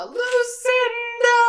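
high, loud, slow drawn-out wail; anguished cry. Retold or imitated. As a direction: cry out for the burning car. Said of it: "Howard does the".

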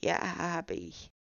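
slow, put-on frail old man; feeble old voice. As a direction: drag it out like a tired old man. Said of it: "den altersschwachen Tonfall beizubehalten".

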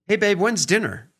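husband playfully asking his wife about dinner; light and playful. Shown as a demonstration. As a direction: ask it lightly, curious not pushing. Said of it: "light and playful and, you know, inquisitive".

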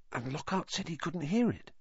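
soft, high-pitched puzzlement; hushed query. A narrator's reading. Boy questioning friend in sleeping dormitory, hushed. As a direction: ask it quietly, doubtful. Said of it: "Keeping his voice low".

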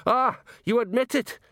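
very high, excited outcry; high-pitched accusing yelp; triumphant outcry. A narrator's reading. Outraged man pounces on a confession.

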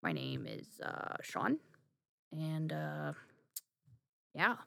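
low, near-whispered flat introduction; mumbly boyfriend impression. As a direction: drone it out like someone half-asleep. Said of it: "my boyfriend is more like".

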